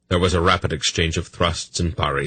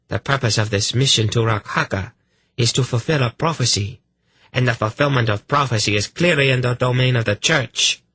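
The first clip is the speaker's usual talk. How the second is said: indignant priestess voice; 6 semitones higher than usual. Acted.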